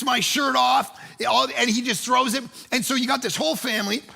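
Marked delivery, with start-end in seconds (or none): none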